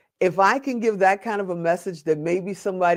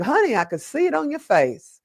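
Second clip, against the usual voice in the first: high, sweet Southern librarian voice, pitched well up; a kindly Southern lady calling him honey; Southern accent; 9 semitones higher than usual. Retold or imitated. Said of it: "she had this Southern accent".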